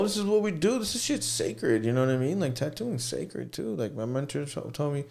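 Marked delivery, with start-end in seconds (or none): 0.0-3.7 earnest. Real, not acted. Insisting tattooing is sacred.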